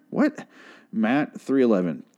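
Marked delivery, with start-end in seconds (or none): 0.1-0.5 shocked what. Real, not acted. Baffled by the tract's text.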